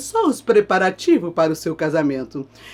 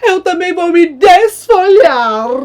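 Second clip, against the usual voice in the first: high, loud wailing scream; hammed-up screaming lament; mock screaming grief; 13 semitones higher, 10 dB louder, slower than usual. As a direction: scream in despair like a grieving tree. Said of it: "começou a gritar".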